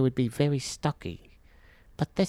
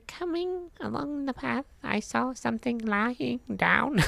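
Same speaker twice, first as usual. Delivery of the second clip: alien voice; 8 semitones higher, 3 dB quieter than usual. Acted. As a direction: read it like an alien. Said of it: "I sound like an alien".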